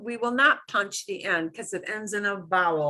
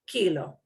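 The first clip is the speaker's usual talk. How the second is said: Kilo with KI stressed; Kilo, lean on the first syllable. Shown as a demonstration. Teacher showing stress rule to students, Kilo stressed on KI. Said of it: "We punch the second to last syllable".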